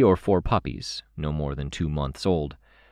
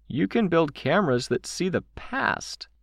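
very high character voice, piping up; child calling out; 12 semitones higher than usual. A narrator's reading. Chicken Little encouraging Kenzie, calling out. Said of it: "Chicken Little called out".

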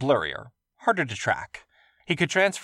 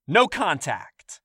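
stern command; 7 semitones higher, 4 dB louder, much slower than usual. Acted. Guard ordering.

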